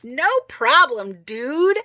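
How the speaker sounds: slow, high and loud breezy reply; hammed-up casual dude reply; overly casual. A narrator's reading. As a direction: play it cool a bit too hard. Forced breezy reply to dad.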